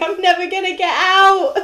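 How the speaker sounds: high, loud hammed-up wail; rising comic panic; mock panic. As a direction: wail as if trapped, for laughs. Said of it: "there's just this panic where".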